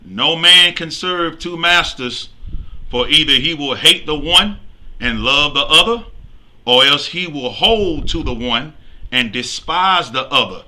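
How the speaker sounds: loud, measured Bible reading; scripture reading. Acted. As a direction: proclaim the verse to the congregation. Reads scripture aloud.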